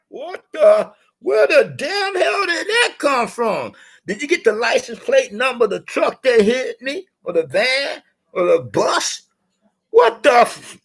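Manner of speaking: a knocked-out fighter asking what hit him; stunned Russian boxer. Retold or imitated. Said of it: "that Mad Russian was like".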